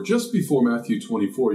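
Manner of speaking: usual speaking voice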